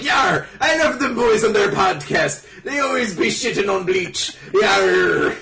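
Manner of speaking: loud, high hammed-up pirate; Mister Jump praising the podcast as a shouting pirate. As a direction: bellow it like a cartoon pirate. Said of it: "Mister Jump himself, who's just like".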